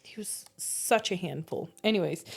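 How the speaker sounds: normal talking voice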